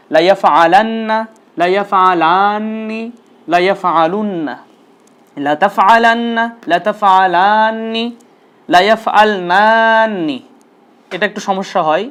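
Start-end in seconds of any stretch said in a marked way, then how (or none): 0.1-10.4 slow, deliberate chant of the forms; slow recitation drill. Shown as a demonstration. Teacher leading students in slow repeat-after-me drill. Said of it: "আবারও বলছি".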